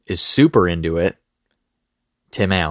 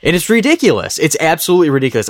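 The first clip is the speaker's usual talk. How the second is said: worked up; 6 semitones higher than usual. Real, not acted. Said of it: "I get so worked up".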